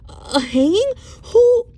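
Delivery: very high, loud horrified cry; shocked cry. Acted. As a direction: gasp it out in horror. Shocked at hanging news.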